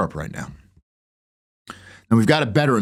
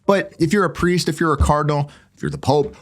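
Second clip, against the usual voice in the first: normal talking voice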